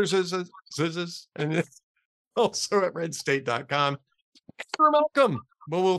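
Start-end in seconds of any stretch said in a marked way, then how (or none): 4.8-5.4 announcer sign-off. Real, not acted. Host announces guest sign-off.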